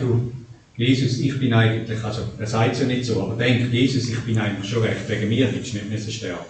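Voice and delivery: low, fast, self-satisfied muttering; a smug churchgoer talking to Jesus; smug self-satisfaction. Retold or imitated. Proud communicant tells Jesus he's fine, low and smug. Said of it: "wenn jemand das Abendmahl nimmt und sagt".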